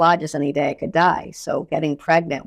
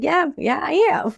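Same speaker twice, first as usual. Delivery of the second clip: high sulky admission; 9 semitones higher than usual. Retold or imitated. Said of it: "I was like".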